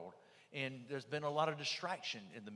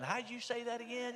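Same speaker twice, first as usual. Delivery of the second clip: higher, imitating sheepish UN officials; mock eager officials; 10 semitones higher than usual. UN agencies asking for his wording, higher mock voice. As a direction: echo the rivals now begging for the trick.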